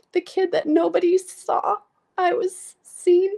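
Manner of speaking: real tears, fighting to get the words out; crying. Real, not acted. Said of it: "sorry, I'm gonna cry".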